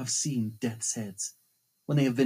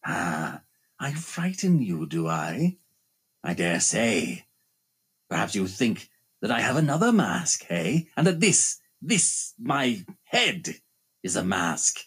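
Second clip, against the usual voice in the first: hissing phantom voice; 6 semitones lower than usual. Acted. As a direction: taunt her with cold contempt. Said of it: "Then he hissed at me".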